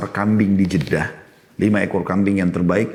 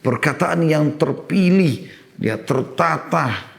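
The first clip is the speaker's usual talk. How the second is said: slow, deliberate; 7 semitones higher, much slower than usual. Shown as a demonstration. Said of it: "Allah perintahkan kita menggunakan kaulan karima".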